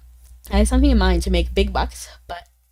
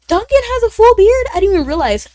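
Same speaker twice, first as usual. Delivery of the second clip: high, loud, incredulous question; real surprise; 13 semitones higher, 10 dB louder than usual. Real, not acted. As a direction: blurt it out in astonishment. Streamer reacts to news about Duncan.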